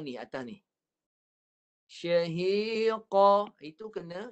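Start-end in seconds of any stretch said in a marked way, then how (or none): 1.9-3.5 syahiqa drawn out slowly, chanted; slow tajwid recitation. Shown as a demonstration. Teacher recites syahiqa slowly for the class. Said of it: "yang qa tu baris pertama mesti diba".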